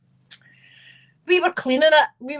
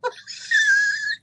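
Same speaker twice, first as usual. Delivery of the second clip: shrieking laugh. Real, not acted. Laughing at dad's drive-through joke, high shrieking laugh. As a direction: burst into a squealing, tearful laugh.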